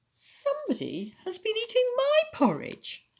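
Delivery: very high, singing Mother Bear voice; mellow mother bear. A narrator's reading. Mother Bear finds her porridge eaten, high mellow voice. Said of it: "medium-sized voice".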